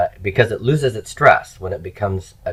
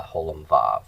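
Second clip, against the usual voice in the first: usual speaking voice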